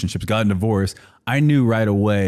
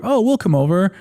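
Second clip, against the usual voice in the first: lightly voiced parents, pitched up high; casually offer, in a higher imitated voice; parents' voice; 10 semitones higher than usual. Son imitating his parents offering to visit, lightly put-on high voice. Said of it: "they're like".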